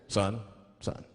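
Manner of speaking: low, deliberate cop voice; police officer. Acted. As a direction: drop low like an officer at a traffic stop. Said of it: "the police pull me over".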